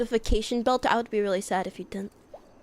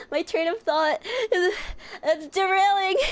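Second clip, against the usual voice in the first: climbing high, comic alarm; rising mock panic; 9 semitones higher than usual. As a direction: let the voice run off the rails. Mock panic about losing thought.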